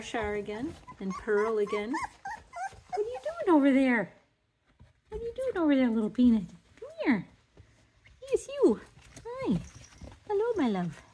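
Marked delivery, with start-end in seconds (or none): 2.9-11.0 high loud cooing call to a puppy; doting pet-talk, pitched way up. Real, not acted. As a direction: call the little one over in a sky-high voice. Calling puppy over, baby talk.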